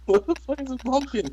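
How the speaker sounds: fast, high, disbelieving; squealing at a weird lyric; baffled squeal. Real, not acted. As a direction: blurt it high and quick in confusion. Baffled outburst.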